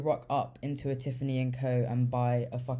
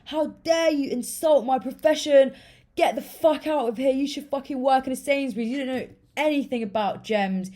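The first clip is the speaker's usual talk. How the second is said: high, loud outraged rant, imitating him; furious telling-off, acted out at speed; pissed off; 10 semitones higher, 6 dB louder, faster than usual. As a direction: tear into someone in an offended mock shout. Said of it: "how fucking pissed off he was gonna get".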